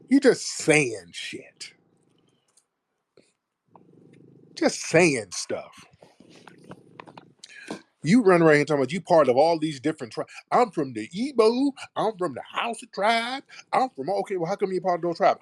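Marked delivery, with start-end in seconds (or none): 0.1-5.8 slow dismissive. Real, not acted. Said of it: "dismissive slow repetition". 10.5-14.1 mocking quote. Mocking caller's tribe claims.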